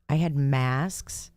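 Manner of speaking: usual speaking voice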